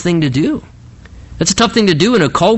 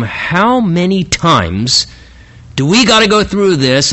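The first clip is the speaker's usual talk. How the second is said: exasperated, dragging out HOW MANY TIMES; fed up, slow opening words; irritated; 3 semitones higher, slower than usual. Acted. King weary of the prophet's same routine, irritated. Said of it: "very irritated response of King Ahab".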